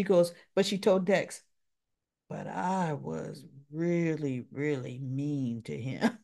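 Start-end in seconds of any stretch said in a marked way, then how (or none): 2.3-6.2 quiet, slow, lower admission of guilt; soft regretful confession. Retold or imitated. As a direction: let the regret sink in softly.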